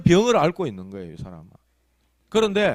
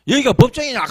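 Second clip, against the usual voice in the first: imitated indignant yell, high and loud; mock outburst, pitched way up; retold shouting; 10 semitones higher, 7 dB louder than usual. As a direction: mimic someone yelling back in a hearing. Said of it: "하면서 막 감지르고".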